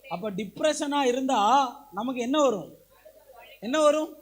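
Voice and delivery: usual speaking voice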